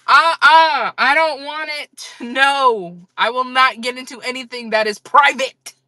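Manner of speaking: high-pitched, emphatic protest; hammed-up indignant no; mock outraged refusal.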